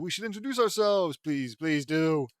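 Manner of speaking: loud, rising eager urging; eager plea. Acted. Rabbit-meeting character urges everyone to introduce themselves, loud eager plea. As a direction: beg with gusto, drawing out the words.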